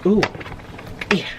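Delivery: ordinary talk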